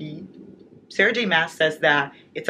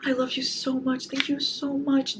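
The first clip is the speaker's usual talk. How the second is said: much higher and slower, squealing with love; gushing thanks; 9 semitones higher than usual. Real, not acted. Vlogger gushes to a fan who sent a gift. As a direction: gush your thanks.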